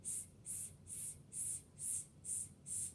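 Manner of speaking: only the hiss of s, no voice; unvoiced s sound. Shown as a demonstration. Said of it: "Let's try it".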